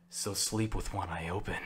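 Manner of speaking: low, quiet, dead-serious threat; hushed, grave menace. Retold or imitated. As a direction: say it like a deadpan ninja warning the crowd. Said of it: "just, like, very serious voice".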